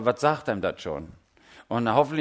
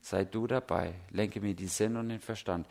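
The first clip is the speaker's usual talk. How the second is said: hushed, softer prayer to Jesus; quiet prayer; 4 semitones lower, 8 dB quieter than usual. Retold or imitated. Preacher voicing a quiet everyday prayer, hushed. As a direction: pause and pray softly, inward. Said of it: "innehalten und sagen".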